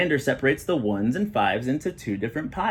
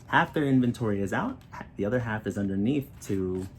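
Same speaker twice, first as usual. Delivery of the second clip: ordinary delivery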